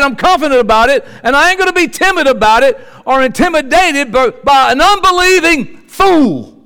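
loud, high-pitched sermon delivery; full-force preaching. Real, not acted. Preacher tells an unbeliever he is bold and confident, full-force preaching. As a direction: drive it home loudly to the doubters.

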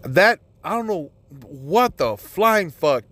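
ordinary talk

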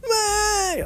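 high wailing mock sob; mock crying. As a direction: whimper it like an overdramatic crybaby. Mimicking Trina crying over letters.

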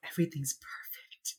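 whispered, deadpan reply; flat whisper. Retold or imitated. Husband answers his wife in a whisper. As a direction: hush it out like a tired husband. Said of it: "And he goes".

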